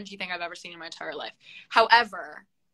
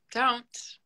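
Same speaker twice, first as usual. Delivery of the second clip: ordinary talk, nothing marked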